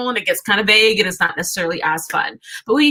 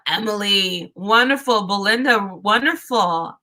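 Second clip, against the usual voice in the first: normal talking voice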